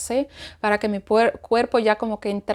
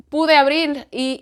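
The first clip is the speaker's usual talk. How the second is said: usual speaking voice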